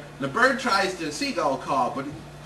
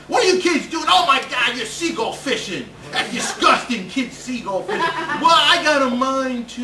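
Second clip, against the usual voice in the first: put-on loud, higher old man yelling at kids; angry old fisherman; 6 semitones higher, 6 dB louder than usual.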